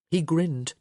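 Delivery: ordinary delivery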